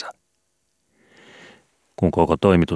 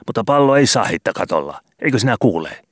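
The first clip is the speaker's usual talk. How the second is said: a higher, louder scolding landlady; 6 semitones higher, 3 dB louder than usual. Acted. Landlady snaps at the boy on the roof, scolding. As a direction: nag the boys like a cross landlady.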